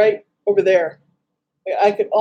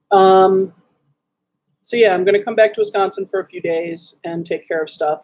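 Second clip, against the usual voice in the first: usual speaking voice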